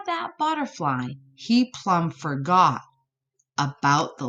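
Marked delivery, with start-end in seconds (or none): none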